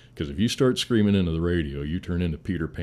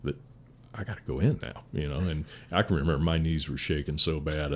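ordinary talk, nothing marked

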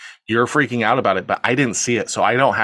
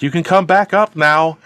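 higher, louder mock call down the stairs; calling back; 7 semitones higher, 5 dB louder than usual. Woman telling him to come back upstairs, raised mock call. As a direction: holler it down to someone below. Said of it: "responds back".

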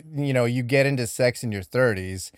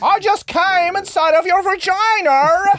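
much higher and slow, silly put-on voice; mock Lovecraft voice; 4 dB louder, slower than usual. Cohost joins the Lovecraft bit in a high, slow joke voice. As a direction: pick up the joke voice and stretch it out.

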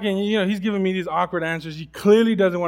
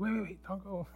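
pleading; 13 dB quieter than usual. Retold or imitated. Begging teen to stay.

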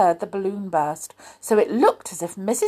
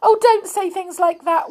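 high tearful protest; weeping; 10 semitones higher, 4 dB louder than usual. A narrator's reading. Amelia begs the Clockwork Mouse to stop, weeping. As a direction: plead in a storybook wail. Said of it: "wept Amelia".

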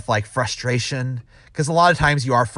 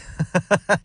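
short, high chuckle; laugh; 6 semitones higher than usual. Real, not acted. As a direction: laugh at your own line. Laughs at own mock speech.

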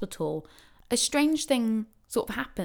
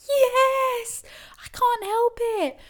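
much higher, strained admission; strained high yes; 3 dB louder, slower than usual. Retold or imitated. Labouring woman answering the receptionist, high and strained. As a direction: squeak it out mid-contraction.

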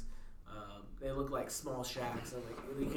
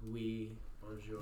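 French accent; 4 semitones lower, 7 dB quieter, slower than usual. Shown as a demonstration. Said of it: "Just say regular, like, French things".

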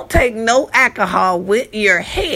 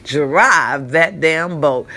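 ordinary talk, nothing marked

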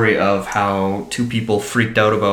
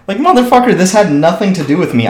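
much higher and louder, outraged; indignant outburst; 9 semitones higher, 7 dB louder than usual. Real, not acted. Telling a friend about the threat, furious and outraged. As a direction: explode at the unfairness of it.

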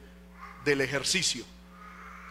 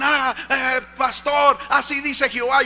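loud, raised, self-important prophecy delivery; mock phone prophet; 6 semitones higher, 7 dB louder than usual. Preacher mimics callers phoning him with prophecies, loud and high. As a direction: imitate an urgent caller proclaiming a message.

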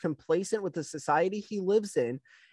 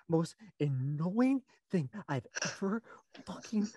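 ordinary talk, nothing marked

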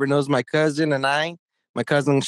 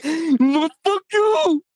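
very high mock retort; hammed-up high-pitched refusal; put-on defiant voice; 12 semitones higher, much slower than usual. Mimicking the helper refusing in the race story, mock defiance. Acts out Sergio's reply, laughing.

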